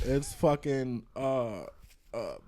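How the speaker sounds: ordinary delivery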